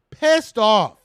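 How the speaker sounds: heated loud stress; loud emphasis. Real, not acted. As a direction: slam the words pissed off. Fans angry after loss.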